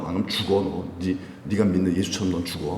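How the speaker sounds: low, hushed threat; threatening. Acted. Council threatens apostles with death, low and menacing. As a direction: menace them under your voice. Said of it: "막 이러면서 막 협박하고".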